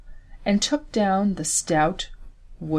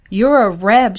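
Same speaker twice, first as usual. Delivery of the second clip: louder, sure accusation; woman accusing the soldier, firm declaration; 2 semitones higher, 10 dB louder than usual. Acted. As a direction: nail him with it. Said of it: "she declared".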